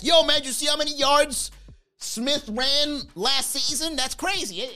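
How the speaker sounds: high excited sports fan; sports bro. Retold or imitated. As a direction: hype up the stats, slow on the name. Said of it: "I'm not that kind of guy".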